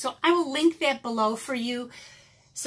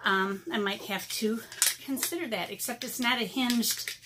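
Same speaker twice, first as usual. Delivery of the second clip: ordinary talk, nothing marked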